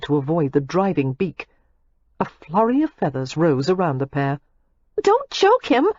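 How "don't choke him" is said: much higher and louder, urgent; young woman crying out in alarm. A narrator's reading. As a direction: cry out to stop him hurting the goose. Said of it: "Daisy cried".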